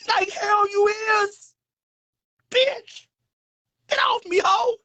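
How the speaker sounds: very high mock screech; outraged rejection. Throwing the lover off with a high, outraged squawk. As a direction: shriek it in hammed-up indignation.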